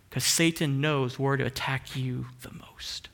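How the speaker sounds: hushed, ominous aside; warning about Satan in a whisper. Acted. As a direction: whisper it like a secret danger.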